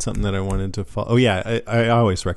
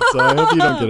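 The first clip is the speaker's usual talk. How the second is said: laughing; 4 dB louder, faster than usual. Real, not acted. Just laughed at caller's sound.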